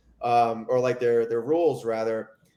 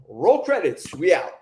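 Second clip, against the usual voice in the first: upbeat sign-off; 4 semitones higher, 5 dB louder, slower than usual. Real, not acted. Show sign-off catchphrase.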